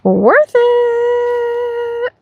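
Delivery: very high goofy voice; high silly voice. Pretend. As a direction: sing it out high and playful. Silly remark.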